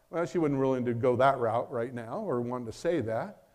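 ordinary talk, nothing marked

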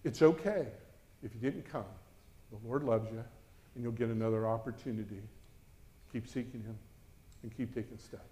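soft, kind pastor's encouragement; quiet, encouraging words from a mentor; gentle reassurance. Retold or imitated. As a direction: reassure a scared teenager softly. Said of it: "He goes".

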